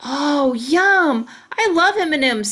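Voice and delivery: high and loud, bursting with delight; excited. A narrator's reading. Grace thrilled about M and M's, high excited squeal. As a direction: squeal it like a happy kid. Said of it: "said Grace".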